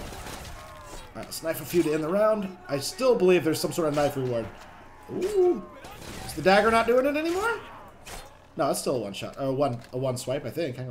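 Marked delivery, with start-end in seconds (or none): 5.1-5.6 high-pitched startled ooh; surprised "ooh". Real, not acted. Surprised by gameplay moment.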